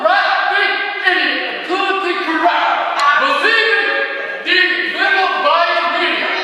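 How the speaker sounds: heated protest yelling; angry rant. Real, not acted. Ranting shout at city council.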